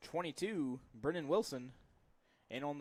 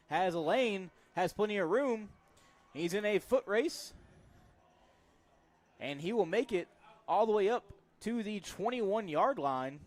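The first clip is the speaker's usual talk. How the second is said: rising, louder call of a big run; excited play-by-play; 5 semitones higher, 7 dB louder than usual. Real, not acted. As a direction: build the call as he breaks free.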